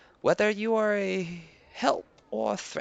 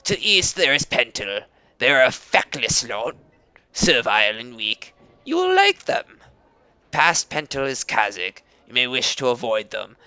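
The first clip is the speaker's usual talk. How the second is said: Leader Koba, proud; 9 semitones lower, 6 dB louder than usual. Acted. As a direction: greet guests with stiff pride. Koba speaks as NPC.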